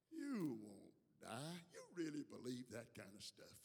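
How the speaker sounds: lightly voiced low tempter, slow at first; low insinuation, still fully voiced; mock tempter's whisper. Serpent tempting Eve in her ear, mock whisper. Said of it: "He's whispering in her ear".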